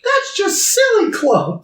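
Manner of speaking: very high, drawn-out silly voice; mock dismissal. Friend echoing the joke about Silly Club, hammed-up dismissal. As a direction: squeal it slowly, hammed up. Echoes the mocking line, hammed up.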